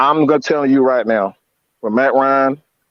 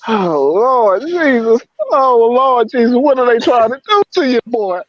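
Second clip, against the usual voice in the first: distraught lament; 11 semitones higher than usual. Real, not acted. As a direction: lament to the Lord in a raised, stretched-out voice. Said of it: "I'm just so emotional right now".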